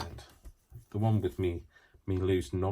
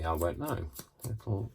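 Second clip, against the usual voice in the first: ordinary talk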